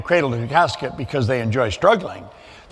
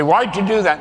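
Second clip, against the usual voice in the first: lightly voiced mother asking why; a hint of a mother scolding her little boy; 3 semitones higher than usual. Mother scolding her kid, mild mock exasperation. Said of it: "my mother".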